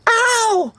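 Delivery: very high-pitched shriek of pain. A narrator's reading. Smurfette has her hair bitten, shrieking. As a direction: shriek out a squealed "ow". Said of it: "Smurfette shrieked".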